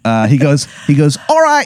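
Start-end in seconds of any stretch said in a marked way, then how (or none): 1.3-1.7 squeaky-high Baltimore character voice; bad Baltimore accent. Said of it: "this Baltimore accent".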